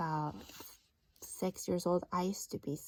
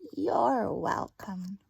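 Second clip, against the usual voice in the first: drawn-out, unhurried welcome; the dark answering Laszlo slowly; slow gracious reply; 4 dB louder, much slower than usual. Acted. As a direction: take your time with each word.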